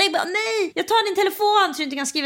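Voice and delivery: high, fast imitation of a pushy friend; mock bossy friend. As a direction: rattle it off like a friend grabbing the phone. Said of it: "Han bara". (snabb, ljus härmning av en påstridig kompis)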